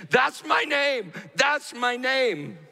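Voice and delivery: high, slow, emphatic cry; impassioned quoted proclamation; exultant retold declaration. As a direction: proclaim it drawn out and high, as a revelation. Monk retells Jesus declaring his name.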